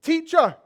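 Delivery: "Teacher" hit high and loud; stressed teacher. Retold or imitated. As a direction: lean hard on "Teacher". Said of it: "'Cause they say".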